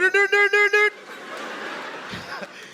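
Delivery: put-on high rapid "d-d-d" in Indian accent; mock Indian accent gibberish. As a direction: rattle off Indian-accented d sounds. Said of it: "the funniest accent is the Indian accent".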